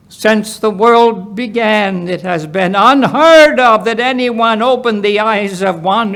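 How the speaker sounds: bold healed man. Acted. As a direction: build his case with rising conviction. Healed man's final argument.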